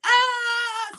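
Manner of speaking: very high, drawn-out "ah"; high mock scream; imitated wake-up scream. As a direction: mimic a morning scream on one long note.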